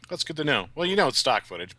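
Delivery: ordinary delivery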